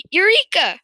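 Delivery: high, loud exclamation; triumphant cry. A narrator's reading. Farmer learning of the engine oil, crying triumphantly. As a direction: burst out with the discovery. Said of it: "cried Mister Cranky".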